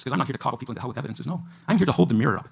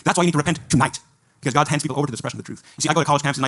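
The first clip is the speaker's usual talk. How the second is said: louder, higher, driving the point home hard; fiery preaching; 4 semitones higher, 5 dB louder than usual. Acted. Preacher urging the audience to repent, fiery and loud. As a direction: preach it full force at the crowd. Preaching at full force to crowd.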